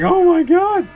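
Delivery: high, loud, mock-horrified cry; shocked exclamation. Streamer mocking his own shocked reaction to the chat. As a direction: throw up your hands in disbelief. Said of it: "I'm just like".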